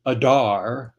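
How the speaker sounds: Adar said uh-DAR, like "ajar"; "Adar" with weak first vowel, stress on the end; mistaken pronunciation. Shown as a demonstration. Signer recalling thirty-five years of error, Adar rhymed with ajar. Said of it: "like a jar".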